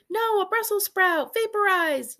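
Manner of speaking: high, loud, delighted; cartoon glee at the vaporizer; gleeful zapping. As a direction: blast it with joy. Character zaps vegetables gleefully.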